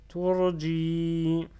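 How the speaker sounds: TURJI with a long held final 'i'; loud recitation, stretch the end of TURJI; prolonged kasra. Shown as a demonstration. Teacher reading to learners, TURJI with its last vowel drawn out. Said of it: "Je prolonge kasra".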